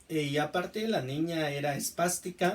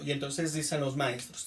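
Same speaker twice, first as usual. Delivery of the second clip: ordinary talk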